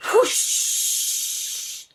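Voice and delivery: loud breathy whoosh of flames. A narrator's reading. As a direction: blow out the fire sound. Narrator imitates flames.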